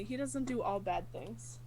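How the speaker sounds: ordinary delivery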